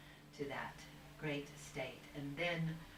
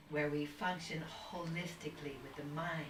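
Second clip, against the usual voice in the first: ordinary talk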